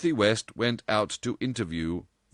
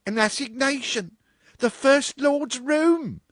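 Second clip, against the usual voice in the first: much higher and louder, slow, scandalised supervisor; astonished cry; 5 dB louder than usual. Acted. Supervisor hearing of the assignation, scandalised high cry. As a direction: repeat it in shocked disbelief. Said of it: "cried Miss Cunliffe".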